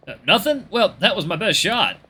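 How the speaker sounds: high, loud, jokey giving up; sheepish Luke. Acted. Luke after failing to magic the tire, sheepish. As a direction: shrug it off, pitch well up.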